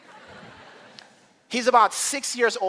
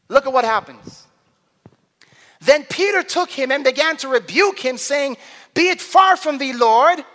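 forceful preaching; 7 semitones higher, 5 dB louder than usual. Real, not acted. Preacher reading the resurrection to the congregation, forceful preaching. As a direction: proclaim it, stretching the big moments.

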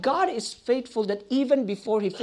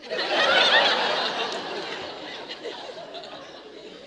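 high, breathy laugh of disbelief; incredulous laugh. Retold or imitated. Preacher showing his inner reaction to his own answer, amazed laugh. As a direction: laugh as if you can't believe what you said. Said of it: "I had this expression ... like".

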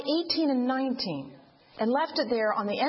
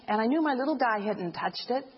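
normal talking voice

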